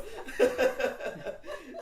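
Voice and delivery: loud, high peals of laughing; real laughter. Real, not acted. Teller amused by her teenage secret, laughing loudly. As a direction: keep cracking up.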